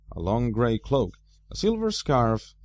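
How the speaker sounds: plain reading voice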